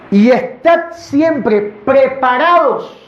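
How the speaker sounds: slow, high, drawn-out reading of the verse; solemn scripture reading. Acted. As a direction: proclaim each word of the verse slowly. Reads scripture aloud.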